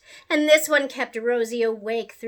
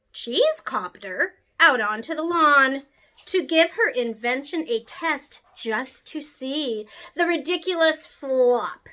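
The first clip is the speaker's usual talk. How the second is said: ordinary delivery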